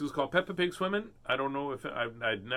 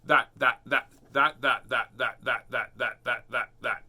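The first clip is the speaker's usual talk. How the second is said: a quick, loud run of repeated words; rapid-fire repetition; 3 semitones lower, 6 dB louder, slower than usual. Shown as a demonstration. Reading to kids, tries a tongue twister fast. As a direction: rattle off that as fast as possible. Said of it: "Say that ten times really fast".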